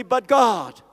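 loud declared response; congregation call. Real, not acted. Preacher leading the congregation, loud declaration. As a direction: lead the church in the phrase. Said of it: "Everybody say after me".

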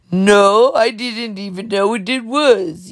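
attempted country accent, slow and drawn out. Wrangler answering her in the dream, slow mock country accent. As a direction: put on a rough country accent, slowly. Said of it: "with this country accent".

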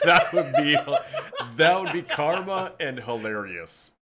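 slow, loud words through laughter; laughing. Real, not acted. Laughing hard at the idea of Thanos dusting himself. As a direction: savour the joke while laughing.

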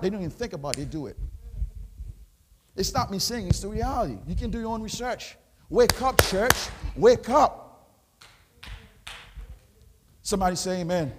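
ordinary delivery

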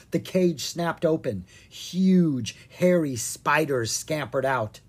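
plain reading voice

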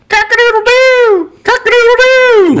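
very high, loud crowing rooster; rooster ringtone. Retold or imitated. Imitating the man's ringtone, a loud rooster crow. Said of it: "it started going".

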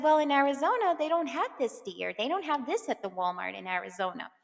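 a hint of mom justifying herself; lightly voiced mom. Mom explaining her stuffed suitcase, lightly imitated.

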